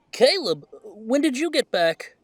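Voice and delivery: high, loud startled greeting; surprised. Acted. Talia spots Caleb back home, high and startled. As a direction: caught off guard, thrilled.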